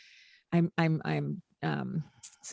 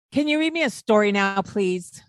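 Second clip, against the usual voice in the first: louder, higher, fast, pushy "now, please"; demanding with please; 4 semitones higher, 6 dB louder than usual. Retold or imitated. Boy demands story from caregiver, pushy with please tacked on. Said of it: "I actually prefer that to".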